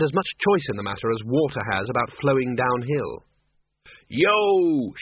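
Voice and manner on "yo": higher-pitched mock battle cry; an excited, half-contained shout. The Dean hollers as he blasts trolleys, excited shout. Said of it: "shouted the Dean".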